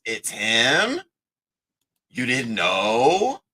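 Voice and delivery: drawn-out, swooping rises on HIM and KNOW; mock questioning. Acting out his reply to the picture, mock-questioning. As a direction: imitate someone asking as if it's news.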